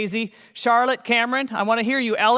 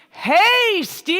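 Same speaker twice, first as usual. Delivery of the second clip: high calling yell to someone far off; 10 semitones higher, 5 dB louder than usual. Shown as a demonstration. Class shouting to Steve so he hears. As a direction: call out across the room. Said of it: "We're gonna yell".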